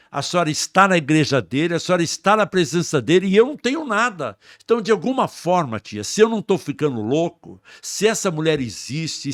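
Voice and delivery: loud, high, driving sermon delivery; fervent preaching. Acted. Preacher retelling talk with his aunt, fervent and loud. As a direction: build the fire in every line.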